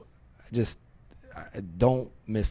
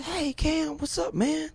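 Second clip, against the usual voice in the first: much higher, bright retold greeting of his buddy; friend's greeting; slower than usual. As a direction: mimic the friend calling out cheerfully. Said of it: "he said".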